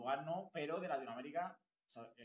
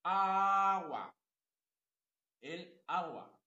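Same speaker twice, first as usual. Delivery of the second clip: "Agua" con la A marcada y alta; énfasis en la tónica; 6 semitones higher, 13 dB louder, much slower than usual. Shown as a demonstration. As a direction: subraya la A de "Agua". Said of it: "¿cuál es?". (pronuncia "Agua" marcando la tónica)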